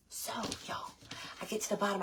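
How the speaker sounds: hushed story whisper. Acted. Telling viewers about the slide in a whisper. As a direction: drop to a whisper for suspense.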